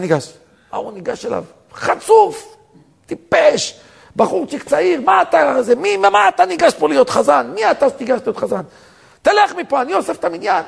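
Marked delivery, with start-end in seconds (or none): 1.7-10.6 high, indignant scolding; angry yelling. Retold or imitated. Older man scolding the young man who took the cantor's place, high angry yelling. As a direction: berate him in a raised, high voice. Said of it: "צעק עליו".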